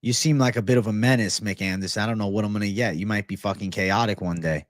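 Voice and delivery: ordinary talk, nothing marked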